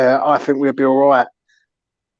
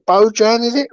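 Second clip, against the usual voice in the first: ordinary delivery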